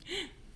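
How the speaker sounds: sharp shocked intake of breath, high; gasp. Acted. Teacher acting Mary's shock at the tomb, gasp. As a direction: gasp as if seeing the open tomb. Mary finds tomb stone moved.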